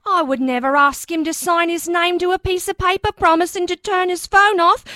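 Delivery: first Cockney take, breaking down; Cockney accent, nearly cracking up; a hint of Cockney.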